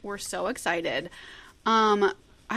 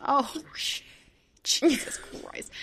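usual speaking voice

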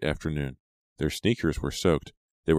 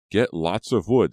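slow, louder, raised call; shouted instruction; 4 semitones higher, 6 dB louder, slower than usual. A narrator's reading. Advisor calls to the group heading off, shouting. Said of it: "their advisor shouted".

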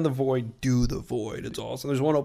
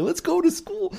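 put-on much higher, eager kid heading to school; little kid voice. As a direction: chirp it like an excited little kid. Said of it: "You look like a little kid going to school".

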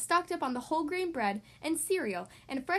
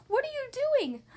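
high, upset cub voice; alarmed cub; 11 semitones higher than usual. Acted. Sister Bear seeing the goodies gathered up, high alarmed cry. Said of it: "cried Sister Bear".

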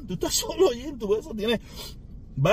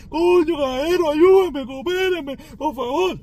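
mock pleading; 6 semitones higher, 3 dB louder, slower than usual. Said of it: "me ven a mí llorando".

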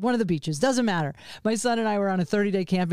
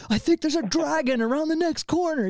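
playful storyteller; 6 semitones higher than usual. Retold or imitated. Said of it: "I'd be like".

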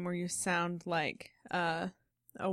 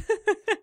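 high laugh; 13 semitones higher, 8 dB louder than usual. Real, not acted. Laughing at joke.

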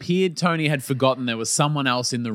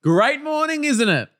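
high, drawn-out mock elation; exaggerated, singing-high delight, swooping down; overblown, high-pitched cheer, imitating a colleague; gushing morning greeting; 10 semitones higher, much slower than usual. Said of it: "And the response".

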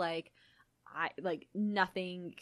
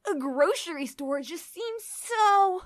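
put-on obnoxious teen boy; 10 semitones higher, 7 dB louder, much slower than usual. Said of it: "make them obnoxious teen boys".

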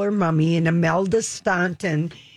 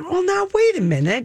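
much higher, wait a minute; surprised objection; 10 semitones higher than usual. Real, not acted. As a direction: pull up short, amused. Mock objection.

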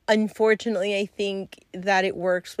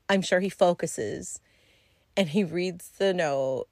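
ordinary delivery

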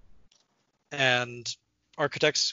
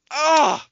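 exasperated cry; 11 semitones higher, 9 dB louder than usual. Real, not acted. Exasperated outburst.

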